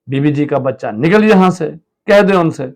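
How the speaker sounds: high and loud scolding; angry scolding. Acted. Husband yelling at the servant to leave, angry. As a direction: order him out irritably.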